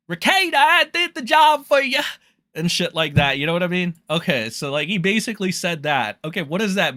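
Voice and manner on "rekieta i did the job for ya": loud, very high mock holler; Ethan Ralph. Rival streamer reporting to Rekieta, imitated in a loud high holler. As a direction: blurt it in an exaggerated high-pitched impersonation. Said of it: "but he was like".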